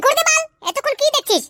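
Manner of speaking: high-pitched, agitated command; exasperated high-voiced goading; impatient order. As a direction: urge him to shoot without delay. Accomplice demands he shoot.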